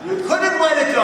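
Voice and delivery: high-pitched imitation of a yelling wife; hammed-up shrill-pitched scolding; mock yell. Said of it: "She yells at me".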